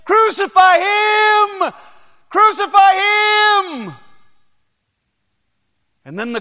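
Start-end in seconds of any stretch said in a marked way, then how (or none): 0.0-4.0 very high, very loud slow shout; crowd shout. Acted. Crowd answering Pilate's question, loud slow shout. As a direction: roar it like a furious mob. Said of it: "he hears the crowd crying out again".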